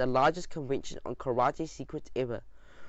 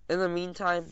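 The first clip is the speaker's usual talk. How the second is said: plain reading voice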